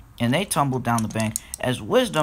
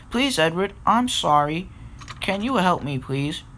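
higher-pitched apologetic plea; pleading; 6 semitones higher than usual. Acted. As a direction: beg gently for help, voice raised in pitch. Apologetic engine pleads for help.

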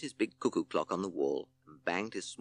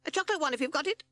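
squeaky bat, much higher and fast; high fast squeak; much faster than usual. Acted. Betty Bat asks the judge in a high fast squeak. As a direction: chirp it quickly and hopefully.

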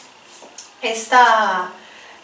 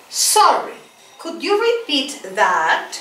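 slow model phrase; slower than usual. Shown as a demonstration. Teacher models for students to repeat, slow and clear. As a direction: model "Sorry, could you repeat that?" slowly for students to echo. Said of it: "Repeat after me".

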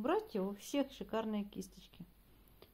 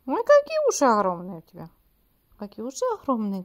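high delighted exclamation; excited squeal; 3 semitones higher, 17 dB louder than usual. Real, not acted. (восторженный визг)

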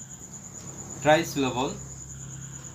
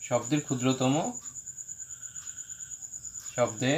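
word by word with long pauses, low-key; board dictation; 3 semitones lower, 9 dB quieter than usual. Real, not acted. Dictating while writing on the board.

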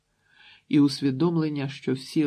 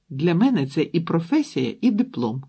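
louder, stressing мене as she exclaims; exclaiming; 4 semitones higher, 6 dB louder than usual. A narrator's reading. Daughter retorts to her father, loud exclaim. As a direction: fling it back defiantly. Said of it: "вигукнула вона".

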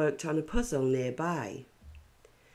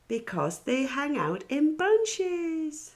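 much higher, slow, goofy punchline; silly punchline voice; 11 semitones higher than usual. A narrator's reading. Noreen telling her banana joke, high goofy voice. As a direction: deliver the joke like a giggly kid. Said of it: "told her favourite banana joke".

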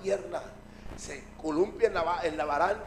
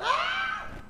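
very high, thin mock scream; drawn-out squeal of pretend terror. Disciples see the shadow coming nearer, high mock scream. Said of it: "Y ellos gritan".

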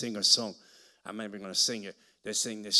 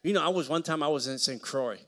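rising into full-volume preaching; booming pulpit preaching; preaching loud; 4 semitones higher, 9 dB louder than usual. Real, not acted. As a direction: lift it and preach it out.

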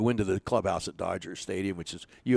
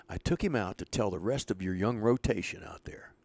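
whispered retold line; whispered confidence; 6 dB quieter than usual. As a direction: let him in on a secret. Bobby confiding.

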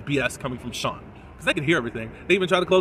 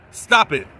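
normal talking voice

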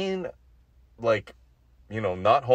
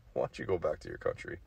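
quiet, quick and offhand; mock quiet jab; 5 semitones lower, 9 dB quieter, faster than usual. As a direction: toss it off softly, half laughing.

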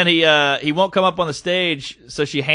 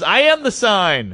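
slow, high retold amazement; imitated excited revelation; flipping out; 5 semitones higher, slower than usual. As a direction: stretch it out like an awed fan. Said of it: "He's flipping out".

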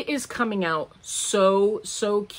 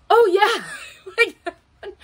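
imitated toddler's delight; 11 semitones higher, 5 dB louder than usual. Granddaughter's eager reply imitated.

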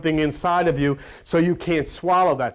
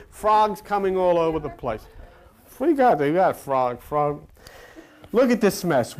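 usual speaking voice

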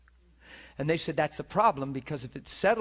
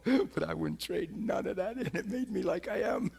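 ordinary talk, nothing marked

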